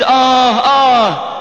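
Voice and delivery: slow, loud drawn-out moaning; retold groans. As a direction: stretch each groan out in pain. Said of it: "ويردد". (أنين ممدود)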